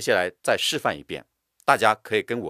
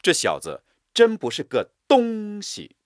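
真不是个东西 lifted higher; stress, drawn out; 11 semitones higher, 4 dB louder than usual. Shown as a demonstration. Teacher repeating the scorn, stressed. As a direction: push up 真不是个东西. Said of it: "声音拉长、断开".

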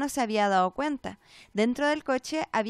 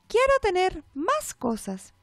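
slow, higher mechanical doll voice; strange doll voice; 6 semitones higher, slower than usual. A narrator's reading. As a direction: drone it like a wind-up doll. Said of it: "voz rara". (voz de muñeca rara y mecánica)

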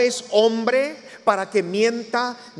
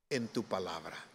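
very soft, low quotation; hushed reverence; 11 semitones lower, 14 dB quieter than usual. Acted. Preacher recalling Peter's words to Jesus, hushed and low. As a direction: say it low and humbly in one phrase. Repeats Peter's key phrase, reflective.

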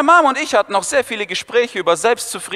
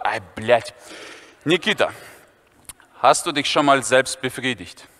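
low conspiratorial schoolboy; Viktor's voice; 7 semitones lower, 4 dB quieter than usual. Retold or imitated. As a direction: lower put-on voice. Viktor voiced, asks question.

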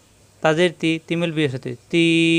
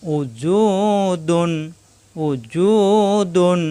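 slow Quranic chant of উজুদুন; slow drawn-out recitation; much slower than usual. Shown as a demonstration. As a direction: stretch উজুদুন with long vowels. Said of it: "দাল দুই পেশ দুন".

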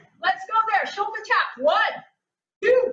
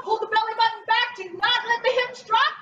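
usual speaking voice

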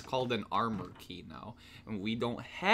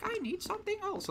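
much higher mock girl voice; girly voice; faster than usual. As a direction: squeak it like a fussy heroine. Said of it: "she's like".

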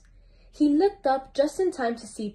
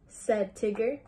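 plain reading voice

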